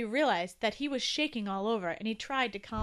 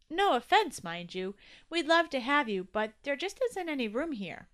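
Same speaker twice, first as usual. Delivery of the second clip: higher, louder friendly explaining; polite young Toby; 4 semitones higher, 6 dB louder than usual. Acted. Toby telling new tooth there's no room, polite. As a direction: break it to the newcomer politely.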